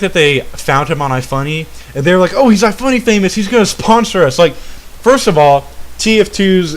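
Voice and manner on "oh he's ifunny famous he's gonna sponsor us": high-pitched sarcastic enthusiasm; put-on higher, eager voice; mock excitement. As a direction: imitate a gullible, starry-eyed fan. Mocks gambling site's imagined reaction.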